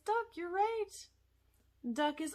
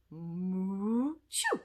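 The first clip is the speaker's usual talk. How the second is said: mooing sneeze. Acted. Cow puppet sneezes.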